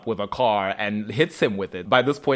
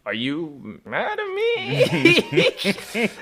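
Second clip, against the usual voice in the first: much higher, very slow, laughing; laughing mock plea; 10 semitones higher, much slower than usual. Stefan asking Bonnie, a high, slow laughing whine. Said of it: "And he's like".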